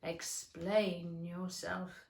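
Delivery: slow, stern demand. Acted. Caterpillar coldly ordering Alice to explain, slow and stern. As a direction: lay down the order slowly. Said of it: "said the caterpillar sternly".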